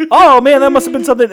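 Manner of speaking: high, loud feigned astonishment; mock amazement. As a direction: blurt it out, hamming up the surprise. Acting out a faked reaction.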